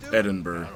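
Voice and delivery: Edinburgh with no G at the end; correct pronunciation. Shown as a demonstration. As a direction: end Edinburgh on 'burruh', not 'berg'. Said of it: "Correct. It's Edinburgh".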